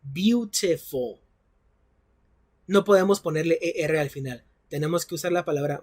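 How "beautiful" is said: "beautiful" drawn out slowly, each syllable clear; "beautiful" spoken slowly in three clear syllables. Shown as a demonstration. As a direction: stretch out "beautiful" so the class can count its syllables. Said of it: "Tiene tres sílabas".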